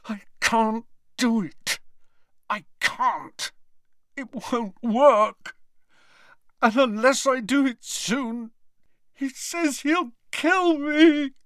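high, tearful, desperate wail; high-pitched anguish, dragged-out words; crying in despair. A narrator's reading. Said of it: "was crying".

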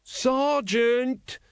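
high, drawn-out cry of alarm; shouting. A narrator's reading. Carrot sees the dragon behind them, shouting. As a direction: call out urgently to warn him. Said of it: "when you keep shouting".

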